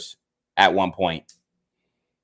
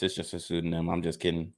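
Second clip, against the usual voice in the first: much softer, tossed-off under the breath; muttered aside; 7 semitones lower, 13 dB quieter, faster than usual. Real, not acted. Vlogger softly joking to viewers about his fake name, much softer. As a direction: drop it away like a private joke.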